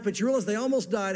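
ordinary talk, nothing marked

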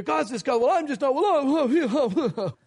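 hammed-up fit that falls apart into "oh, oh"; mock fit. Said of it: "pitch a little fit".

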